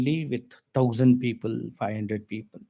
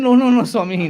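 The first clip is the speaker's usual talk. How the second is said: alarmed protest; 7 semitones higher, 7 dB louder than usual. Retold or imitated.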